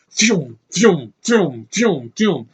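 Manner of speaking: repeated "pew" launch sounds, each falling; imitated shooting noises. Reviewer mimicking a body shooting out like Jack-in-the-Box, mock effects. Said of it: "his freaking body shoots out like".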